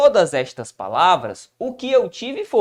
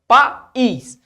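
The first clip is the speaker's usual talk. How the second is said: "País" split in two with a strong, high ís; stressed syllable shown; 5 semitones higher, 6 dB louder than usual. Shown as a demonstration. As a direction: say "País" loudly, pa then stressed ís. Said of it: "onde é que tá a sílaba tônica?".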